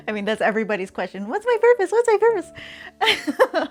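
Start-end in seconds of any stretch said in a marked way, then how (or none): 1.3-1.9 high, loud, dramatic self-questioning; mock existential wail. 1.9-3.7 high, slow, stretched-out whine; drawn-out mock lament. Mock existential question, repeated.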